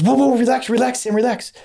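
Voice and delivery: high urgent calming, imitated doctor; doctor calming patient. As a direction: soothe him fast in a high voice.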